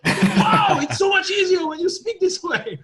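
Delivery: high, loud mock French exclamation; excited French accent. French buddy delighted at being understood, excited French accent. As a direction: burst out amazed in French-accented English. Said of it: "speak in this terrible French accent".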